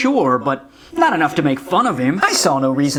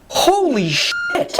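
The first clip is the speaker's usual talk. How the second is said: shocked exclamation; 8 semitones higher, much slower than usual. Real, not acted. Disgusted reaction to clip.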